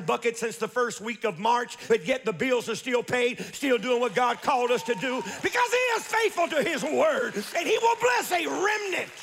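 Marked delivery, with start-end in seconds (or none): 5.4-9.1 high-pitched shouted sermon climax; full-force preaching. Real, not acted. Preacher proclaiming God's faithfulness to the church, full-force preaching. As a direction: burst upward into a preacher's shout.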